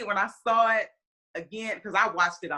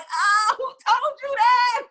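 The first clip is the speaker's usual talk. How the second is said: much higher and louder, slow, with a laugh; caught-out mock surprise; 7 dB louder, much slower than usual. Mom answering her son's teasing, mock surprise with laughter. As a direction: playfully deny being caught. Son says she missed it.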